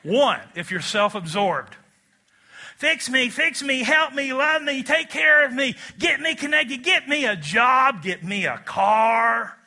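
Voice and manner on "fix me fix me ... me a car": high, loud, pleading and needy; mock whiny neediness; needy whining. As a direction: beg like a self-absorbed leech. Acting out a needy self-absorbed person.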